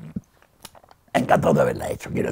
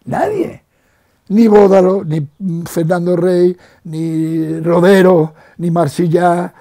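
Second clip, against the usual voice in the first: louder, slow, deliberate list of names; emphatic roll call; 3 semitones higher, 7 dB louder, slower than usual. Real, not acted. Old actor mourning lost friends to the interviewer, loud and slow. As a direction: hammer each name home with feeling.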